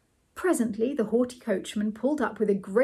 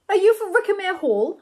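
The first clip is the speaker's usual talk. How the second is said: high, loud formal footman; impressive tones; 10 semitones higher, 7 dB louder than usual. A narrator's reading. Footman addresses passengers at the train window, impressive tones. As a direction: project it grandly, pitch raised. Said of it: "he said in impressive tones".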